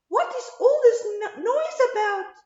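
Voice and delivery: high, loud mouse squeak; little mouse. A narrator's reading. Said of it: "asked the quiet little mouse".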